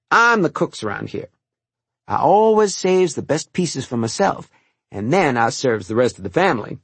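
lightly voiced kindly old cook; a hint of the old kitchen maid, amused; old cook Martha. Old cook thanking the boy for helping, lightly voiced.

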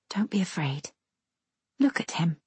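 hushed, soft reassurance; low voice. A narrator's reading. Sister hushes her scared little brother, low voice. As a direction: drop to a near-whisper to calm a frightened child. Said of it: "say in a low voice".

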